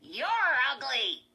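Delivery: squeaky, loud, slow dummy insult; Slappy. A narrator's reading. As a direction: drag out the insult in a high puppet voice. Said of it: "said in Lindy's squeaky voice".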